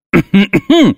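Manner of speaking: loud, high pointed throat clear. A narrator's reading. Ignored teacher demanding attention, loud pointed throat clear. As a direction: clear your throat loudly to get noticed. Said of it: "Громогласно произнёс я".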